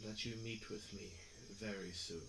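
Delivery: low, quiet, measured god voice; deep mysterious voice. Acted. GM voicing deep entity.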